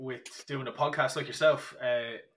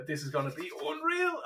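excited. Retold or imitated. Retold excitement over Paris wine.

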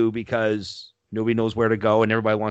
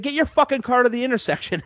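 retold yell; 12 semitones higher than usual. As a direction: bark it at a stalled driver. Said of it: "I just yelled at him".

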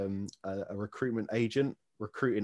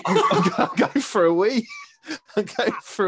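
laughing through the words; 11 semitones higher, 7 dB louder than usual. Real, not acted. Knight telling the host about toilet trips, laughing. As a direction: crack up while saying it. Laughing at his own joke.

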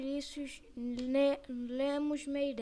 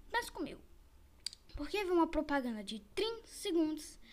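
ordinary talk

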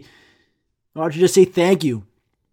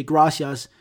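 mock anglicized "Gracias", English R; whitest accent. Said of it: "they say it in the whitest accent ever".

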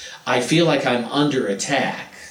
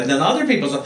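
ordinary talk

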